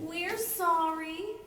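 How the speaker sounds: slow, drawn-out sorry witches; sheepish, slowed-down contrition; sad apology. Acted. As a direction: apologise with mock sadness. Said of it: "looked really sad".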